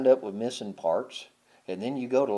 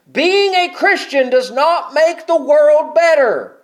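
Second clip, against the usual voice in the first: loud, high, driving sermon delivery; full-force preaching; 12 semitones higher, 11 dB louder than usual. Acted. Preacher thundering to the congregation about Christ's suffering, loud and high.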